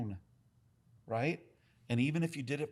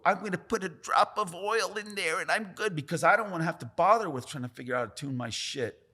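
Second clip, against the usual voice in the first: higher, louder mock voice of a lazy tattooer; mock them, higher and louder, Italian accent not needed, just a sneer in the voice; 5 semitones higher, 6 dB louder than usual. Mocking rotary users who skip tuning, a higher, louder sneering voice. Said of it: "these dick sucks can go".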